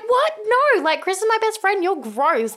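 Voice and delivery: high, fast, disgusted protest; appalled. Retold or imitated. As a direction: recoil in mock horror. Rod appalled by Rose.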